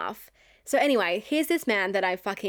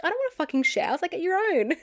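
indignant refusal; 8 semitones higher, faster than usual. Retold or imitated. Retold quarrel over sharing chocolate.

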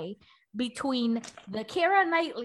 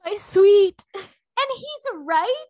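mock sweet; 7 semitones higher, 6 dB louder, much slower than usual.

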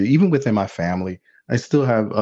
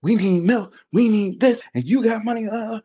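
high, heated mock scolding; mom going off; 10 semitones higher than usual. Mother scolds her kid over hidden money, heated and high. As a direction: imitate mom ranting about money. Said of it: "she goes off".

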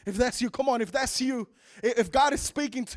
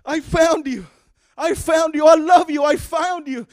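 loud, overjoyed cries; father finding his son alive; joyful shouting; 4 semitones higher, 10 dB louder than usual. Acted. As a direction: shout it out as you hug him. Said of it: "He's like".

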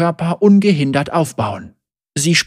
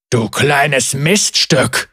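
thundering insult; much slower than usual. A narrator's reading. The wounded leader curses Zeri, slowly and mildly thundering. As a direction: curse the girl who beat him. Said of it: "donnerte durch den Raum".

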